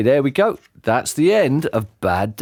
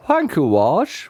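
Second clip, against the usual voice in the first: hint of sarcasm; 7 semitones higher, slower than usual. A narrator's reading. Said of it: "with a hint of sarcasm".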